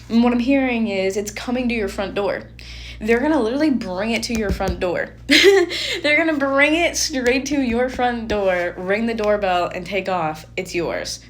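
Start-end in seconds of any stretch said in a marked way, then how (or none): 5.2-6.0 high, loud burst of laughter; short, delighted squeal of a laugh; excited laugh. Real, not acted. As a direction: break into a sudden high laugh. Laughs mid-reading at the delivery.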